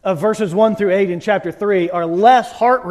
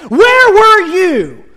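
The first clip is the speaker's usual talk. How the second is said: higher, louder, very slow with heavy stress on where; God thundering; 8 semitones higher, 6 dB louder, much slower than usual. Retold or imitated. God demands of Job, slow, loud and thundering. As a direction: boom the question down at Job. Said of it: "And God goes".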